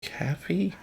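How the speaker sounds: soft, high, questioning "Caffee" with A as in cat; guessed pronunciation. Shown as a demonstration. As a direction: try "Caffee" quietly, unsure, first vowel like cat. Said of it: "How do they say coffee?".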